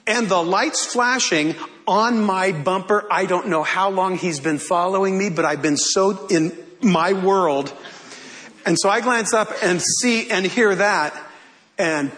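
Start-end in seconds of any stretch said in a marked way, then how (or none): none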